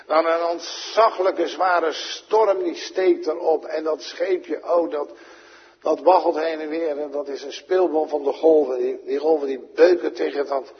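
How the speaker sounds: fervent pulpit narration; dramatic preaching. Acted. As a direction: paint the storm for the church. Dramatic storm telling from pulpit.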